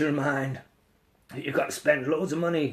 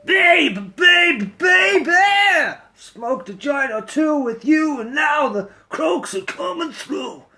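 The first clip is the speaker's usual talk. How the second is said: loud, higher hammed-up shout-singing; hoarse mock belting; 8 semitones higher, 6 dB louder than usual. Singer shouting lines to stream viewers, hammed-up hoarse belting. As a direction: croak it out at full volume, clowning.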